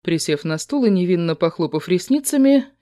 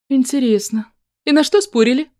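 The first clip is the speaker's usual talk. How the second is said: suspicious; 8 semitones higher than usual. A narrator's reading. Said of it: "с подозрением присматриваясь".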